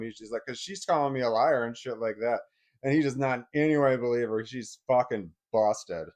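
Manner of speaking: ordinary talk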